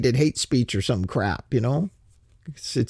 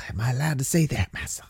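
mock whispered aside; 5 dB quieter than usual.

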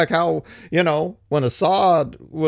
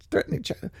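whisper; 10 semitones higher, 9 dB quieter, much faster than usual. Real, not acted. Echoes his own phrase.

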